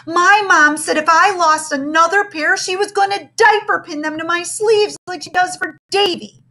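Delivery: put-on high, whiny little girl voice; whiny lament.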